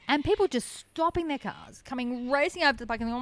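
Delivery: normal talking voice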